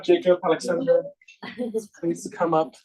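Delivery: usual speaking voice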